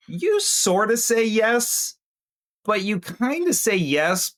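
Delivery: high-pitched, drawn-out sort-of agreement; noncommittal kid-like yes. Acted. Telling Rambo how he agreed to orders, high noncommittal yes. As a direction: agree slowly and high, like a kid who won't obey. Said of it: "like, the way a kid's like".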